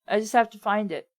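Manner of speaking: normal talking voice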